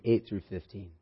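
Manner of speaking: usual speaking voice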